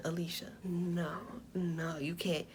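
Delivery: low, very soft, firm pleading; hushed reproach. Retold or imitated. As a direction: talk her down under your breath. Talking a frantic friend down.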